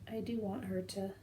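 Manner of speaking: ordinary talk, nothing marked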